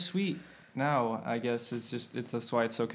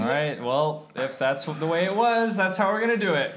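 rallying; 5 semitones higher, 7 dB louder than usual. Acted. Rallying the band loudly.

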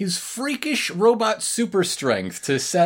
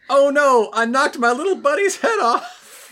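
high, loud, laughing dopey voice; a big lug wailing over his broken buddy, laughing through it; dopey dismay; 9 semitones higher, 5 dB louder than usual. Retold or imitated. Voicing Moose's dismay, laughing.

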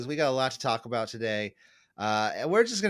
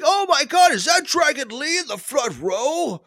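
excited fan gasp; 9 dB louder than usual. Retold or imitated. Imitating a surprised NXT fan.

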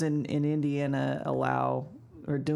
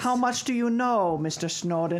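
put-on Russian accent; 3 semitones higher than usual. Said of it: "Is that your Russian voice?".

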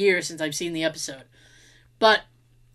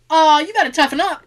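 "gotta toughen up" said louder, mocking and brisk; tough love; 7 semitones higher, 6 dB louder than usual. Sister mocking a sibling who quit, brisk tough love. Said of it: "I'd be like".

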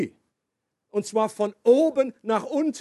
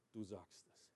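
quiet; 12 semitones lower, 28 dB quieter than usual. Acted. Jesus answers before the high priest.

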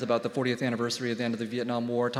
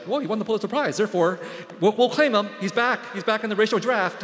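high, eager mock celebration; gleefully claiming him back as one of theirs; excited Vietnamese relatives; 7 semitones higher, 5 dB louder than usual. Relatives proudly claiming the prize winner, high and excited. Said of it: "are like".

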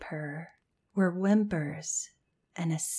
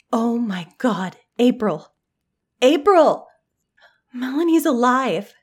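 loud, high urgent cry, building; excited shout; 6 semitones higher, 10 dB louder than usual. A narrator's reading. Hannah calling April to say Melanie's alive, urgent shout. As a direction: call out at the top of your voice. Shouting news to friend in bathroom.

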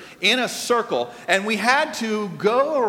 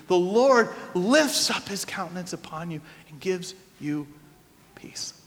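spoken benediction; 3 semitones lower than usual. Real, not acted. Pronounces benediction on congregation.

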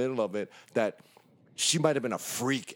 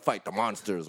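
ordinary talk, nothing marked

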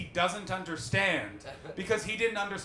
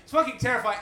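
normal talking voice

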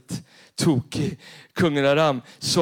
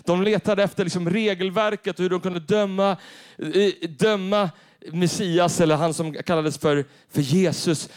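preaching at full force; 3 semitones higher, 4 dB louder than usual. Acted. Preaches about the Pharisees.

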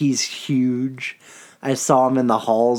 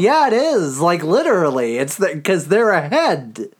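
sarcastic retort; 6 semitones higher than usual. Retold or imitated. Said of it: "And it's like".

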